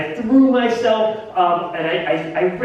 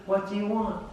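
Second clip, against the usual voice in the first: soft question; 3 semitones higher, 7 dB quieter than usual. Acted. Jesus serving James and John.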